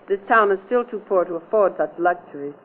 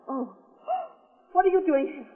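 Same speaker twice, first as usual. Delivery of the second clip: gasping, much higher, frightened question; terrified gasp; 11 semitones higher, 7 dB quieter than usual. Acted. Clerk surprised by the killer, gasping in fear. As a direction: catch your breath in sudden terror.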